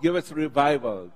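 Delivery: ordinary talk, nothing marked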